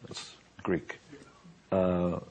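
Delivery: ordinary talk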